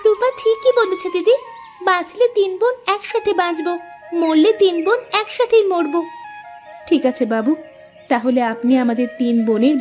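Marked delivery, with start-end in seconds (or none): 0.1-6.0 high-pitched, earnest declaration of loyalty; resolute, in a high voice; impassioned vow. Acted. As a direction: pledge yourself to your sisters with conviction. Sister vows to live or die together.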